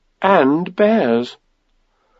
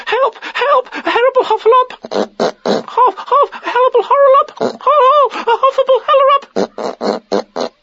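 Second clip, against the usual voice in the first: very high, squealing, tongue-tangled panic; panicked crying out. A narrator's reading. Piglet scampering off, panicked cries. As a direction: squeal it, muddling the words as you flee. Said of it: "still crying out".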